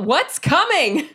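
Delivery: high, drawn-out holler; yelled question. Acted. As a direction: call out the question in alarm. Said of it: "I just yell out".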